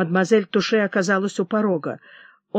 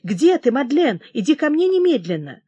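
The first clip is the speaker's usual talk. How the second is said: higher, urgent summons; raised-pitch royal command; exclaiming; 5 semitones higher than usual. A narrator's reading. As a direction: call her to you imperiously. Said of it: "воскликнул король".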